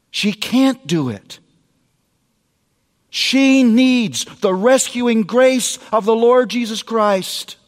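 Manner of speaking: ordinary talk, nothing marked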